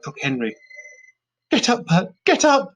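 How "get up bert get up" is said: high loud urgent shout; shouting at Bert in the fire. A narrator's reading. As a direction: yell him back to his feet. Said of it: "she shouted".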